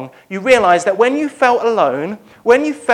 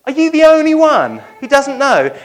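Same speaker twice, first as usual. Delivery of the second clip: high, loud disbelief; can you believe this guy; incredulous; 8 semitones higher, 8 dB louder than usual. Pretend. Cleopas incredulous at stranger.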